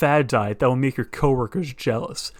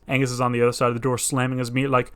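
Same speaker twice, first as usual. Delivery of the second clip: normal talking voice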